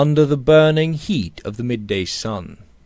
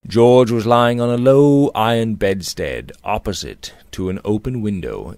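ordinary delivery, nothing marked

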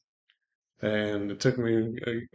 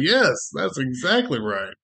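loud, slow and higher, emphatic yes; eager agreement; 4 semitones higher, 4 dB louder than usual. Retold or imitated. Agreeing to the gun sale: loud, slow eager yes. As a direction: lean hard on yes, drawn out.

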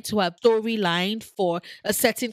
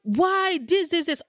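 mock viewers asking; 9 semitones higher, slower than usual. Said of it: "people are going to be like".

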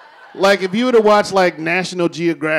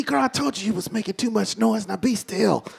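hushed; 7 dB quieter than usual. Acted. Hushing girl again.

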